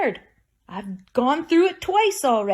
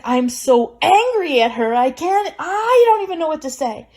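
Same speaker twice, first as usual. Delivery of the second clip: louder, higher mock anger; imitating angry aunt; 5 semitones higher, 6 dB louder than usual. Aunt hearing her sister died, mock angry and loud. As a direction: voice the aunt's outrage, half-acted. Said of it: "she was angry".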